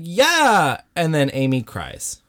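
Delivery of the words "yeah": excited cheer. Retold or imitated. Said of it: "And he's like".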